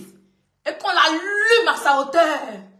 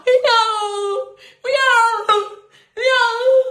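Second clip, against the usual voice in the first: mock cat meows, louder and higher; crying cat; 3 semitones higher, 5 dB louder, much slower than usual. Mocking a rival by meowing like a crying cat, loud and whiny. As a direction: whine each miaou like a crying cat. Pleurer comme un chat.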